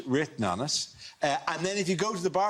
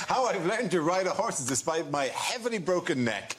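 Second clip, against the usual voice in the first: mock Putin; 5 semitones higher than usual. Voicing Putin in fake photos.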